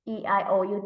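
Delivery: usual speaking voice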